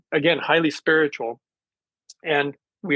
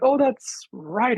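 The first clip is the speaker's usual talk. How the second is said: sudden recollection; 9 semitones higher, much slower than usual. Retold or imitated. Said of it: "And then I was like".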